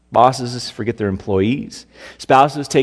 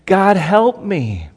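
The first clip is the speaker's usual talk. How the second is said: pleading cry; 5 semitones higher, much slower than usual. Retold or imitated. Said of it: "where you're supposed to go with your frustrations".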